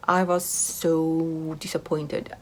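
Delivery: slow, soft, letdown sigh of a line; deflated. Real, not acted. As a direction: let the disappointment sink in, slowly. Sighing over biggest disappointment.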